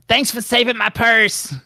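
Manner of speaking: very high squeaky thankful voice; Mrs Bobby Hill voice. Acted. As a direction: chirp it in a cartoon squeak. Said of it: "she's like".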